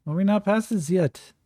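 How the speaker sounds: high, whiny and exasperated; exasperated whine. Acted. Jean-Francois complains to Yakim, whiny French exasperation. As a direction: groan it like he's sick of the topic. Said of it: "And Jean-Francois just".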